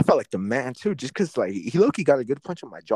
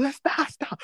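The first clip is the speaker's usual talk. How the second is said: much higher, strained voice begging to stop; mock pleading; 14 semitones higher, 3 dB quieter, slower than usual. Quoting someone in the schoolyard fight, a mock plea to stop. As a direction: squeak it out like you're giving up.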